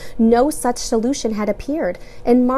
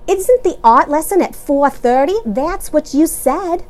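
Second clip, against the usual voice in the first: louder, irritated woman; annoyed; 7 semitones higher, 6 dB louder than usual. A narrator's reading. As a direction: challenge him. Annoyed mother questioning.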